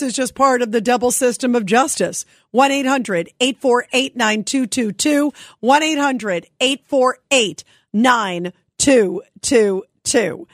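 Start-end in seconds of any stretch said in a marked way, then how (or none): 5.6-10.5 slow down, spacing out the final digits; measured number read-out for listeners to jot down; slow, spaced digits. Real, not acted. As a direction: repeat the phone number slowly. Repeats the call-in number slowly.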